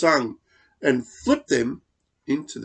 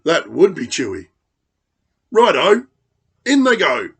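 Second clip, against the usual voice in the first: sly smiling dingo; 4 semitones higher, 9 dB louder, faster than usual. Acted. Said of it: "Dingo smiled".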